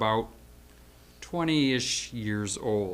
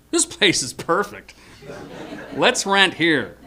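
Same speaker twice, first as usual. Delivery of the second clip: delighted decision; 5 semitones higher, 5 dB louder than usual. Retold or imitated. Tells friend the place is perfect.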